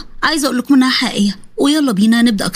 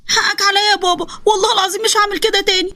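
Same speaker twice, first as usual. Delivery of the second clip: put-on higher, frightened boy's plea; a small boy begging not to be punished; pleading young son; 8 semitones higher than usual. Son begging his father for forgiveness, high frightened voice.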